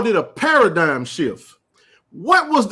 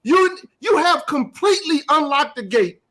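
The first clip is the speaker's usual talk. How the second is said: rising into a full, higher-pitched shout; heated shouting; 8 semitones higher than usual. Real, not acted. Outraged shout at the media rival over poached players. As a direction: build to an outraged yell at the rival show.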